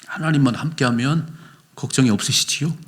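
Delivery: ordinary delivery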